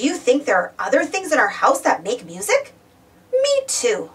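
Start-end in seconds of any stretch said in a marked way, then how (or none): none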